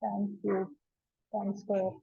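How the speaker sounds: low, soft self-talk while working; talking to oneself. Real, not acted. Teacher checking own working for a mistake, quiet self-talk. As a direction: think aloud quietly, almost to yourself.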